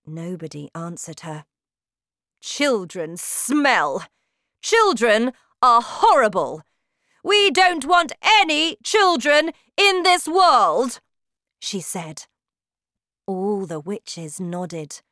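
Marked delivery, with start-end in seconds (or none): 2.4-11.0 shouting witch. Acted. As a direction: bellow like a terrifying witch queen. Witch's hateful speech.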